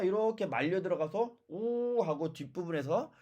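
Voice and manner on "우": drawn-out "우" with the tongue pulled back; vowel demonstration. Shown as a demonstration. Teacher shows students where the back vowel sounds, drawn-out "우". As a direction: hold a long "우" from the back of the mouth. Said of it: "우를 발음해 보세요".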